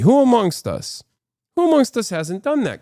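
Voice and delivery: who amongst us, said with a wink; mock indignant. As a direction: play up the fake outrage. Mock rhetorical outrage.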